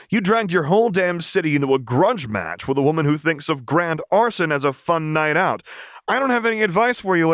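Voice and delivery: a crass Piltover enforcer telling off a noble; rapid, scornful tough-girl rant; brash street enforcer Vi. Acted. Vi's voice in argument.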